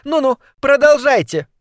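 high, eager urging. Acted. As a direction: egg them on gleefully. Eager girl urges on.

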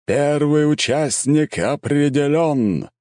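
slow, loud, high proclamation; solemn herald. A narrator's reading. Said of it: "торжественно провозгласил глашатай".

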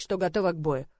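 plain reading voice

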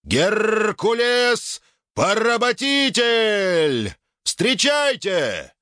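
very high, slow announcer call; booming prize announcer. Acted. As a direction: drag it out to the roaring crowd. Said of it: "ведущий на трибуне разливается соловьём".

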